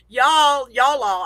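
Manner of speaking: "y'all" and "y'all all" in a light Texan drawl, as a joke; joking y'all. Shown as a demonstration. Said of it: "I say y'all all sometimes as a joke".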